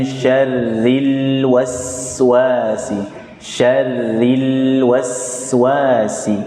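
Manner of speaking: syarril waswasi very slow and clear; model reading. Shown as a demonstration. Teacher modelling syarril waswasi slowly. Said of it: "Ketemu lagi dengan lam sukun".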